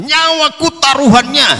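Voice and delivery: bold boast. Acted. Peter boasts, voiced by preacher.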